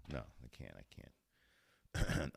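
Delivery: usual speaking voice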